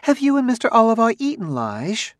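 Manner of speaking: very high, quick, falsely cheerful wife; synthetic gaiety. A narrator's reading. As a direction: chirp it brightly with slightly forced gaiety. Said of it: "with a gaiety that was slightly synthetic".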